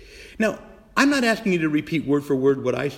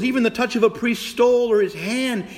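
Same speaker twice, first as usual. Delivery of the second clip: ordinary delivery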